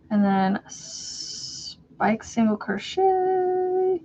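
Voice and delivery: slow drawn-out words. Real, not acted. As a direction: stretch it out while concentrating. Drawn out while working.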